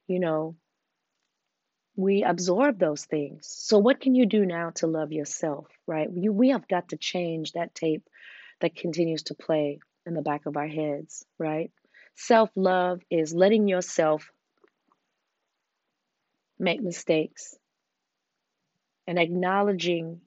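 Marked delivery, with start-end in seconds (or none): none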